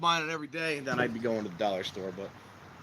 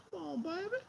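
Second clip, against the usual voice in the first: much higher, coaxing the flame like a pet; high coaxing falsetto; 8 dB quieter than usual. Real, not acted. Man urging his sterno fire to catch, high coaxing falsetto. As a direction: sweet-talk the fire in a high voice.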